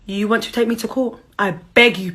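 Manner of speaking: ordinary delivery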